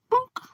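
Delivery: a squeaky high "bonk" like a bouncing ball; high bonk sound. Retold or imitated. To friend, mimicking a bouncy ball with a high bonk. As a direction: pop the sound out high and loud.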